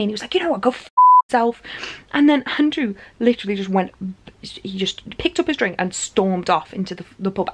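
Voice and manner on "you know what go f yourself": fast, high, loud acted outburst; losing it. Friend swears back at beggar outside pub, imitated losing it. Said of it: "Andrew lost his sh-, like, instantly".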